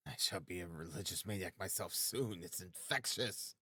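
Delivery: very soft, muttered to oneself; hushed aside. A narrator's reading. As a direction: drop to a barely audible private thought. Raskolnikov's private thought, voiced very low.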